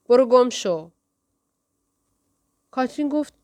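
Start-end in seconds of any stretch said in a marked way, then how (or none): 0.1-0.9 a hostile growled brush-off, louder. A narrator's reading. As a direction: snarl it at her to go away. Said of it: "کاملاً خصمانه غرید". (غرش خصمانه و بلندتر)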